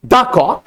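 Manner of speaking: "the" drawn out as a full "daa"; stress on THE. Shown as a demonstration. Teacher pointing at this one bird, stress on "the". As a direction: punch "the" before the noun. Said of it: "इसके बारे में तो मैंने काले वाले".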